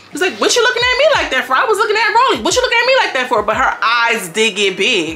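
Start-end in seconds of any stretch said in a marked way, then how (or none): 0.4-3.4 high, fast imitation of an indignant challenge; mock confrontation. Girl retelling a face-off over a look, high-pitched mock indignation. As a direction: fire it back high and quick.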